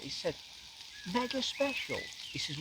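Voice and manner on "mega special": high, soft incredulous echo; imitated disbelief. Photographer astonished at the storyteller's doubt, high incredulous echo. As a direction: throw the words back high and hushed, as if astonished.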